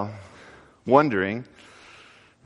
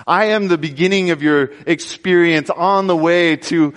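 preaching at full force; 6 semitones higher than usual. Acted.

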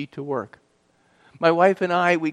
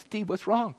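higher, softer, worried question; concerned wife; 7 semitones higher, 7 dB quieter than usual. Retold or imitated. Wife alarmed, embracing crying husband.

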